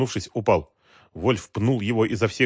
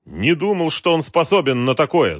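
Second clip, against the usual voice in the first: raised, higher, resentful voice; angry retort; 8 semitones higher, 6 dB louder than usual. A narrator's reading. Galkin answering Wolf's rant, resentful. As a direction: answer back angrily. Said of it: "зло ответил Галкин".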